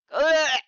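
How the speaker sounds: sharp loud gasping ugh. Acted. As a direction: gasp as if choking. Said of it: "gasped Briggs".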